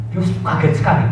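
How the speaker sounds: usual speaking voice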